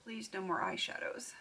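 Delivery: hushed pleading whisper. Real, not acted. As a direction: whisper a little prayer to the wheel. Pleading with the name wheel.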